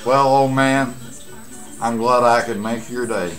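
usual speaking voice

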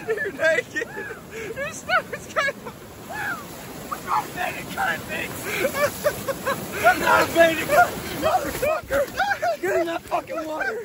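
high yelling hype; hyped up. Real, not acted. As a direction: pump yourself up, shouting. Hyping up into cold water.

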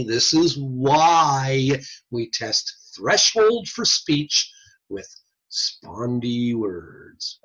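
drawn-out delivery, word by word; slow, emphatic conclusion. Real, not acted. Mock-grand conclusion of the explanation.

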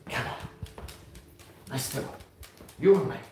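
usual speaking voice

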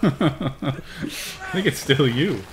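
words squeezed out through laughter; laughing. Real, not acted. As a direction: giggle through the tease.

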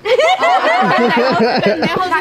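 breaking into high laughter; giggling curse; laughing. Real, not acted. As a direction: laugh mid-insult. Laughing at her own swearing.

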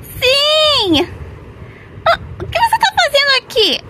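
high, fast startled question; surprised. Acted. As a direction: caught off guard. Surprised finding her in bathroom.

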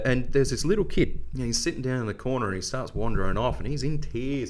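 normal talking voice

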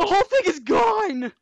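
high, loud shocked outburst; shocked shout. Real, not acted. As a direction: yell it in disbelief. House blown up by griefer.

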